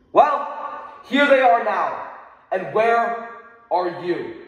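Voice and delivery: an accusing call to the empty chairs. Real, not acted. Confronting absent Congress.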